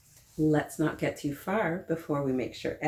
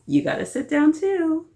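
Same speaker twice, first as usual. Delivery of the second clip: much higher and louder mock scolding; playful puppet scolding; 11 semitones higher, 7 dB louder than usual. Storyteller playfully scolding her flamingo puppet, high and loud. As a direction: tease the puppet into sitting down.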